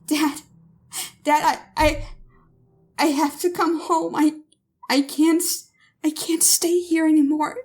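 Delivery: high, panicked, breaking pleas; a scared young woman begging her father; frightened daughter. Acted. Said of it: "She's distorted by fear".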